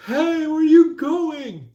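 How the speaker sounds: playful high calling-out; mock shouting call. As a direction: shout after someone driving away. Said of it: "They're like".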